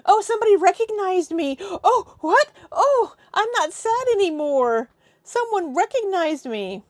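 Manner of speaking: high, loud gushing imitation; mock delight. Mocking her snapping out of sadness when recognized, fake delight. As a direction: gush with hammed-up surprise at being noticed.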